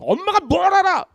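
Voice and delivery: high, defiant kid talking back, mock; mock a teen sneering at mom; sassy talkback. A grown child scoffing at mom, mock sassy and high.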